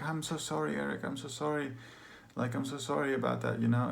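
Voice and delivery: high, soft retold apology; imitating tearful mother. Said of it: "she's bawling".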